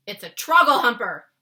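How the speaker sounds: loud anguished outburst; loud furious giant's shout. A narrator's reading. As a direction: rage at the bad dream. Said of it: "he shouted".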